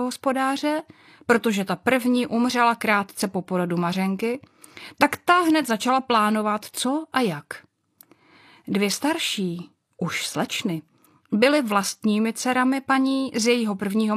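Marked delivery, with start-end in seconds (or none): none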